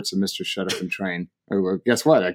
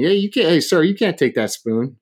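raised-pitch mock objection; waitress objecting; 6 semitones higher than usual. Waitress stopping a diner taking a spoon, objecting. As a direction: jump in to stop a customer. Waitress stops him in retold story.

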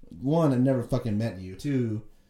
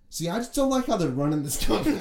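higher, louder imitation of a griping worker; mock complaining; 6 semitones higher, 5 dB louder than usual. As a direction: air a grievance as the cleaner, pitched up. Acting out the complaining cleaner.

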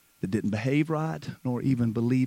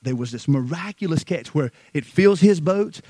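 normal talking voice

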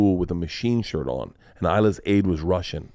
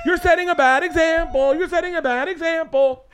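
put-on very high kid voice, sing out the complaint; mimicking scolding kids; 3 dB louder than usual. Kids telling off a dad, high-pitched mock scolding. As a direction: squeal it like mocking children, each time alike. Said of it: "now the kids are like".

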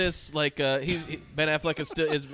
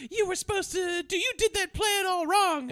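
mock scolding woman. High scolding voice of a woman blaming her partner. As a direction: scold him in a high put-on voice. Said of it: "then she goes".

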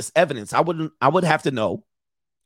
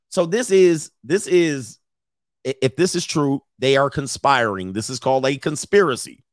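ordinary talk, nothing marked

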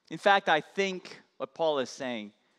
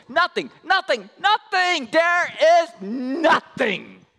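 higher, louder, each nothing hammered; emphatic shout; 8 semitones higher, 6 dB louder than usual. Acted. Preacher driving home that nothing separates God's love, shouting. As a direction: shout it with full conviction. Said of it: "he is so emphatic".

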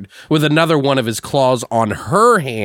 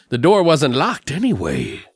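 imitating the killer; 3 semitones higher than usual. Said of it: "this is how he talks when I say this".

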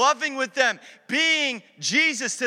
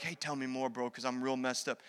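soft plea; 9 semitones lower, 13 dB quieter than usual. Retold or imitated. Voicing a troubled person.

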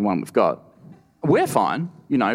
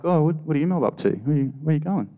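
Badger's casual voice; 6 semitones higher, faster than usual. Badger quoted, friendly.

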